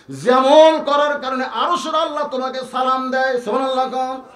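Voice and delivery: high-pitched sermon storytelling; impassioned preaching. Acted. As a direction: preach the question out to the crowd. Preacher at full force.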